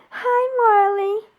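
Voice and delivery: loud tearful "Hi, Marley" drawn out slowly; tearful hello. Real, not acted. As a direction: call the dog's name through tears. Greeting the dog twice.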